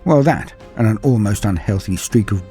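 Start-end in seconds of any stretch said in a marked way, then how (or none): none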